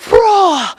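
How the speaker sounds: short high roar of rage, imitated; mock angry roar. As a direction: let out a mock furious "Rah!". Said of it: "Well, Gwen exploded".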